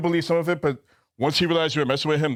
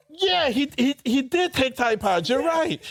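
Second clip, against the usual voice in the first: mock eager; 11 semitones higher, slower than usual. Mock eager agreement with the guy.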